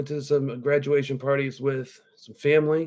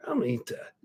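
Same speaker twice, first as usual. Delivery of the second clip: breathy whisper, imitating the sketch; whispered sketch quote; 3 semitones lower, 9 dB quieter, faster than usual. Podcaster quotes a comedy sketch to listeners, whispering. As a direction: whisper it like a secret threat. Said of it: "it's almost like that I Think You Should Leave".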